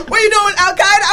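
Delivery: high, loud Jamaican mother impression, laughing; mock a scandalized mother in Jamaican accent. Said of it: "what accent I'm doing".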